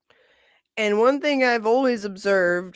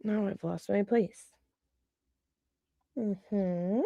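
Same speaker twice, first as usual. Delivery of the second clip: much softer muttered aside; under her breath; 9 dB quieter than usual. Real, not acted. Lost her place reading chat, soft mutter. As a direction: mumble it to yourself.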